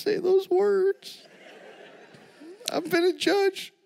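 lifted, drawn-out character voice; high slow put-on voice. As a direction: stretch the words out high and slow. Acted character, far and high.

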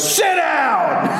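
high, barked order; commanding preacher. Retold or imitated. Preacher orders the heckler to sit, sharp command. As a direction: snap him into his seat. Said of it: "John looks at the guy and goes".

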